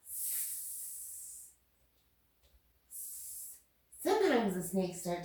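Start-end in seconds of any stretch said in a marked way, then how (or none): 0.1-3.5 long, very soft voiceless sss; snake hiss. Pretend. Teacher showing kids the cobra, snake hiss. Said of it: "so you wanna make a snake sound".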